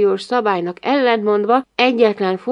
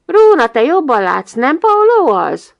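loud, raised, eager question; calling out; 6 semitones higher, 9 dB louder than usual. A narrator's reading. Narrator prompting Róna about the boy, calling out. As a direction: call it across to prompt the listener. Continues the exclamation to the girl.

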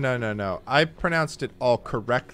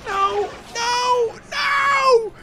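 very high wail, building louder; anguished screaming; 6 dB louder, slower than usual. Real, not acted. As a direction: cry out over lost game money. Losing the game money.

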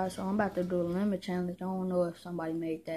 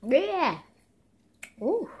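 high, loud yelp; 8 semitones higher, 6 dB louder than usual. Real, not acted. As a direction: yelp it out from the sourness. Reacting to sour lemon.

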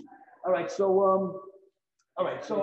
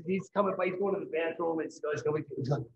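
ordinary talk, nothing marked

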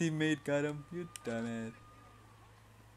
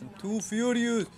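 frustrated shout; 7 semitones higher, 8 dB louder than usual. Real, not acted. Frustrated gamer shouting at game.

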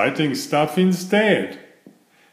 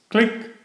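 camera click sound; 9 semitones higher than usual. A narrator's reading. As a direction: mimic a camera snapping a photo. Camera taking photos.

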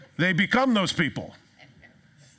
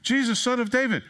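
crying out; 9 semitones higher than usual. Acted. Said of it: "this guy cries out".

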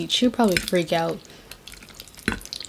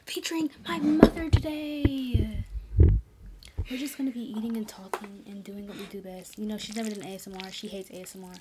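soft ASMR voice; 8 dB quieter than usual. Acted. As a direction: speak hushed like ASMR.

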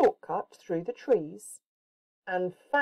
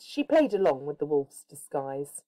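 usual speaking voice

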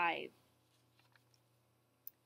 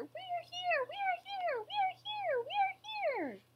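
very high, squeaky chant; fearful tiny cries; slower than usual. A narrator's reading. Whos crying out to be heard, fearful squeals. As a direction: pipe it up as tiny frightened people. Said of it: "They cried out in fear".

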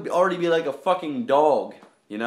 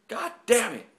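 frustrated; 8 semitones higher than usual. Real, not acted. Trick failed.

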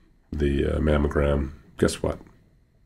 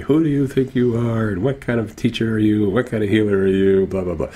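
mock yelling; 6 semitones higher than usual. Imitating a patient challenging her healer, mock yelling. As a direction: imitate an outraged scolding, pitched high. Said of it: "she was yelling at me".

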